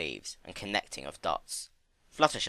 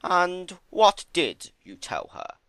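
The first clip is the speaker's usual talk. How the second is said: too casual; 10 semitones higher, 7 dB louder, slower than usual. A narrator's reading. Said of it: "a touch too casually".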